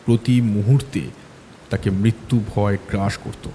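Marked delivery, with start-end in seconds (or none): none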